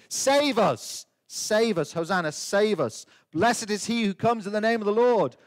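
ordinary talk

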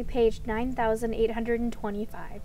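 ordinary talk, nothing marked

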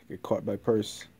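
normal talking voice

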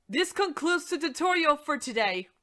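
normal talking voice

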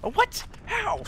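very high squeak of disbelief; can't believe what just happened; shocked disbelief. Real, not acted. Player stunned by a teammate's play, high shocked squeak.

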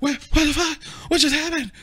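high, loud shrieking disbelief; shocked squeal. Retold or imitated. Girlfriend back from the bathroom, shrieking in disbelief. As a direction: shriek it like you just missed everything.

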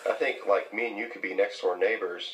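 ordinary talk, nothing marked

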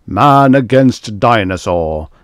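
loud, slow, drawn-out title; dramatic title announcement. A narrator's reading. Screen flashes a lurid title, slow and booming. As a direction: boom it out like a film title.

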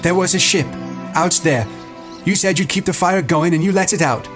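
high, upset reproach; shaken accusation. A narrator's reading. Ralph facing Jack over the lost ship, shaken. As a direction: accuse him, pitched high with feeling. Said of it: "his voice shook".

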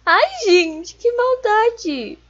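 high, loud, drawn-out mock outrage. Real, not acted. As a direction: wail it in playful shock.

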